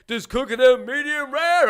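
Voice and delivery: high, loud imitation of a yelling kitchen cook; hammed-up shouted impression; barking cook. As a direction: holler it like a barking chef. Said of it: "he was in the back barking and yelling at people".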